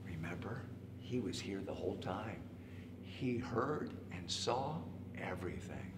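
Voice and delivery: soft, low, confiding near-whisper; hushed aside. A narrator's reading. Narrator letting children in on a secret, hushed and low. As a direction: confide it very quietly, slowing down.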